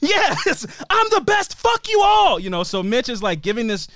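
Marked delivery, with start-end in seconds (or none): 0.0-2.4 high yelled boast; gloating shout. Retold or imitated. Winning kid boasting to everyone, shouted. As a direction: crow over the crowd.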